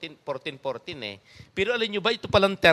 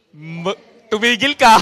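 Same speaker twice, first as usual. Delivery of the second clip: loud, slow mock order to your neighbour, laughing; half-laughing, loud and slow, bossy tumigil ka; laughing command; 5 semitones higher, 11 dB louder than usual. Shown as a demonstration. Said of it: "Sabihin nga nyo sa inyong katabi". (natatawang malakas at mabagal na utos)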